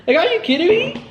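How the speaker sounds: incredulous. Retold or imitated. Disbelief at Noah.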